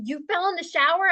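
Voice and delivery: high, startled question; shocked disbelief. Retold or imitated. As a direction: gasp it out in disbelief. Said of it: "then it got to".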